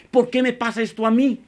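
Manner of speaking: high-pitched, self-justifying protest; mock complaint. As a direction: imitate a believer complaining to God. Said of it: "Muchas veces le dices a Dios".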